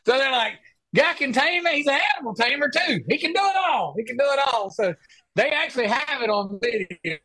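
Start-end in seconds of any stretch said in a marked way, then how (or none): 0.9-4.7 pitched way up, quick and gushing; mock amazed buddies. Friends at the pasture marveling in a high gushing voice. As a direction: hype him up like a wide-eyed fan.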